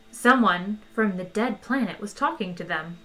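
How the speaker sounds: ordinary delivery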